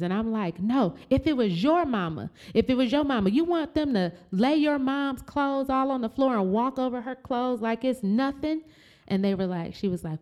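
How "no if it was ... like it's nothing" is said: heated confrontation. Retold or imitated. Confronting staff woman.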